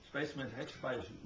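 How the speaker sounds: ordinary delivery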